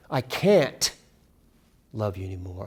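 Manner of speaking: heavy weight on "can't"; stress on CAN'T. Shown as a demonstration. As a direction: hit "can't" hard and stop before going on. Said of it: "Emphasize individual words in the title".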